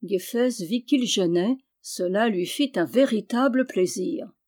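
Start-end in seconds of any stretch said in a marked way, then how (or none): none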